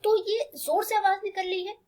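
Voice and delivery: ordinary delivery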